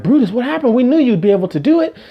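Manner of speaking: high, fast and eager; excited crowd. Acted. Townsfolk greeting Brutus, fast and eager. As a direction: gush over the returning hero.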